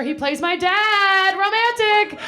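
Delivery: high drawn-out indignant wail; mock-outraged squeal. Real, not acted. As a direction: squeal the word romantic in disbelief.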